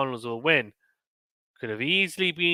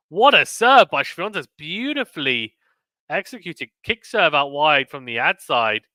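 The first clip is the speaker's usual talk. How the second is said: live play-by-play; 3 semitones higher, 7 dB louder than usual. Real, not acted. Commentator praising Swiatek's serve out wide, excited play-by-play. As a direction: hail a great serve, then describe it.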